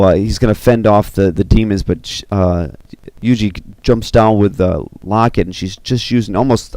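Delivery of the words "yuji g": ordinary delivery, nothing marked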